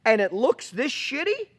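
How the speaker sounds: mock furious tirade; retold angry cussing. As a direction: chew him out like an angry boss. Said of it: "cusses me out".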